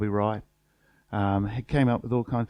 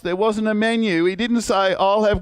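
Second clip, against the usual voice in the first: exasperated retort; 8 semitones higher than usual. Retold or imitated.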